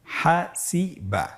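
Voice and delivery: slow, syllable-by-syllable "হাসিবা"; word read out. Shown as a demonstration. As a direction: separate "হাসিবা" into drawn-out syllables. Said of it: "প্রথম শব্দটি বলা আছে". ("হাসিবা" ধীরে ভেঙে ভেঙে)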